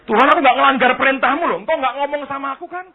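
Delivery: loud, quick self-defence; acted protest. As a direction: argue back loudly as the devil. Iblis memprotes kepada Tuhan. (membela diri dengan lantang)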